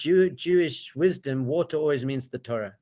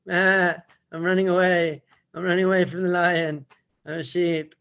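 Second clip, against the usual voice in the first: higher, louder bleating sheep voice; sheep-like lion cub; 4 semitones higher, 4 dB louder than usual. Acted. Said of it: "the lion cub looks at him and he's like".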